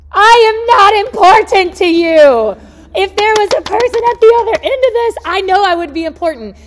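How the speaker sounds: high, loud exasperated yelling; frustrated scream. Acted. Caller on hold answers the recording, acted frustrated scream. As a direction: scream it at the automated line. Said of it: "You wanna just scream".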